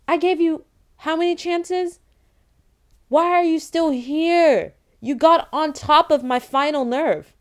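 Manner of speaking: high-pitched, exasperated scolding; exasperated telling-off. Retold or imitated. Acting out confronting the man, exasperated.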